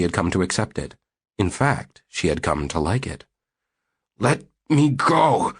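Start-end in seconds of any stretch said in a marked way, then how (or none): 4.2-5.5 slow, breathless plea, higher; gasping man. Acted. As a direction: gasp it out, pinned down. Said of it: "he gasped".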